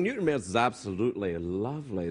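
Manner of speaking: low posh Glasgow voice, imitated; posh Glasgow accent. Host imitating Newton Mearns locals, posh Glasgow accent. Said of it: "They talk like this".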